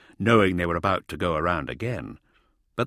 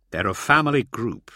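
ordinary delivery

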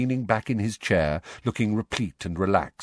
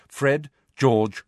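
ordinary delivery, nothing marked

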